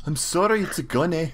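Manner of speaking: put-on high-pitched botched Canadian accent with Russian in it; Russian-tinged Canadian accent. Joking apology about not being understood, Russian-tinged Canadian accent. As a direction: play a Canadian who comes out half Russian. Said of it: "like a Russian starting to become Canadian".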